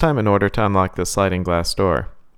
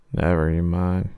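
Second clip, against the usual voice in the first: low clown mutter; 3 semitones lower, 5 dB quieter than usual. Acted.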